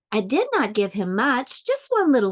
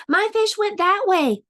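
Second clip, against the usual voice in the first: high-pitched, excited storybook urgency; urgent alarm; 10 semitones higher than usual. A narrator's reading. Fish owner directs the policeman to the fish, acted urgency. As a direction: send help after it in a raised, urgent voice.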